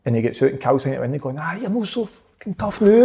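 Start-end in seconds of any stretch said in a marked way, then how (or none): 1.4-3.1 high-pitched mock jeering; crowing, sing-out taunt in retelling; imitated taunt. Friend at car window with police beside him, imitated jeering.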